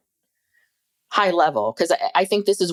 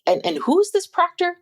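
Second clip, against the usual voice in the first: quoting herself; 13 semitones higher than usual. Retold or imitated. Retelling the car chat with Steve, higher mock voice. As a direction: own question replayed much higher.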